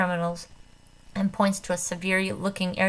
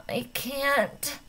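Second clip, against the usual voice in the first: moaning; 7 semitones higher than usual. A narrator's reading. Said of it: "moans Lynn".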